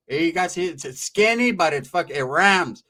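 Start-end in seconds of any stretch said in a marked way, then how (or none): none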